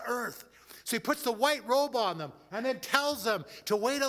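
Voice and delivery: high, loud, rousing sermon climax; full-force preaching. Acted. Pastor rouses the congregation toward heaven, full-force preaching. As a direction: preach it out to the whole room.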